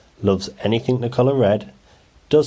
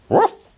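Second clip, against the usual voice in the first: high yappy puppy bark; dog barking; 4 dB louder than usual. A narrator's reading. Patch barking at something he spots, high yappy bark. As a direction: bark like a small excited dog. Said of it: "barked Patch".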